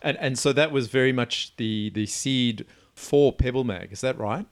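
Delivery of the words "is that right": usual speaking voice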